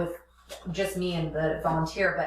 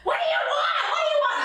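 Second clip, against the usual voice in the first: high shouted, laughing imitation; mock intercom yell. Nurse yelling over the intercom to the sick mother, mock shout. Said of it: "yelling over the intercom".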